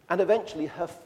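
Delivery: normal talking voice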